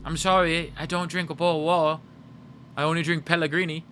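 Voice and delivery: lightly voiced British accent; mock British accent. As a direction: play a snooty Brit. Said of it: "Now do a Taya accent".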